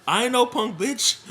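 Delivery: playful Rush Hour impression; Rush Hour style. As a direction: throw out a cheeky film-quote retort. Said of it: "Rush Hour style".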